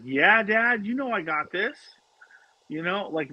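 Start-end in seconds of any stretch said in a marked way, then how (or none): 0.0-1.8 put-on higher, teasing kid voice; daughter playing along. Imitating his daughter playing along with the prank, higher and teasing. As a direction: play along like a cheeky daughter. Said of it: "Maddie's like".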